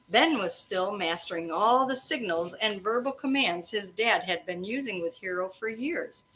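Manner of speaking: usual speaking voice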